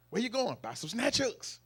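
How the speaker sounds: comic animated retelling; retold excited exchange. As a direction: call out after someone leaving. Retold shouted fishing exchange.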